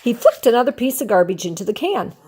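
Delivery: usual speaking voice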